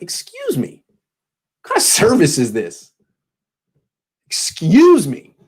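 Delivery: high, indignant, drawn-out Excuse me; mock diva complaint. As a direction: play an offended customer calling for the waiter. Diva customer demanding service at brunch.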